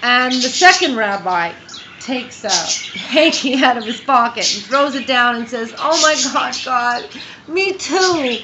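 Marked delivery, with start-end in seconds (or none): 5.8-8.4 high, loud mock lament; anguished wailing. Second rabbi lamenting to God, hammed-up anguish.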